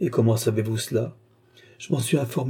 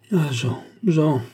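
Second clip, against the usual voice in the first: raised, grateful cry of his name; pleased outburst; delighted exclamation; 5 semitones higher, 3 dB louder, slower than usual. A narrator's reading. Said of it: "s'écria la comtesse".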